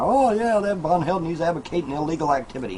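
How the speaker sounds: high, fast sneering imitation of a troll; mocking hater voice. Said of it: "the haters and naysayers and trolls are gonna".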